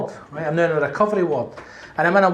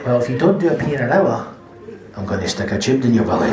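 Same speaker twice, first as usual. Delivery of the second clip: lower, stern nurse warning; firm nurse; 6 semitones lower, 4 dB louder than usual. Retold or imitated. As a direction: lay down the threat like a no-nonsense nurse. Nurse threatens tube.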